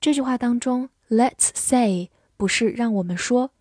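ordinary talk, nothing marked

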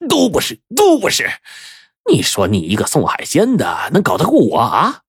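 high-pitched contemptuous repeat, then a sneer; sneering disbelief; scornful snort. A narrator's reading. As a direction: throw their denial back at them. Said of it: "冷哼着说".

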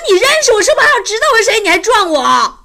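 high, loud, outraged yelling; angry shout at the fan who rear-ended her car; indignant yell. Retold or imitated. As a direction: let him have it, furious and disbelieving.

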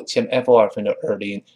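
normal talking voice

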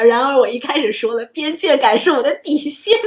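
loud laughing self-mockery. Real, not acted. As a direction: laugh at your own contradiction. Teasing own contradiction.

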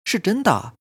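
sudden high-pitched insistence; high, intense assertion in a girl's voice. A narrator's reading. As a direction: insist abruptly, pitch jumping up. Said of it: "她猛然抬起头瞪着我说".

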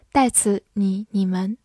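ordinary delivery

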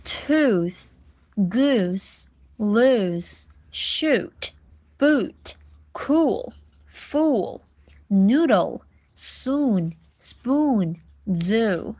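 word list with a long oo, each word apart; careful drawn-out oo from the throat; long OO drill. Shown as a demonstration. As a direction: model each word, holding the oo. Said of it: "它要发长音的乌".

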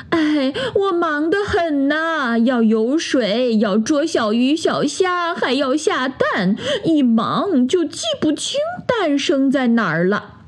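high, slow, put-upon duck sigh; sighing excuses. A narrator's reading. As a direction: complain you're too busy. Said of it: "鸭妈妈叹了一口气说". (鸭妈妈叹着气，慢慢地抱怨)

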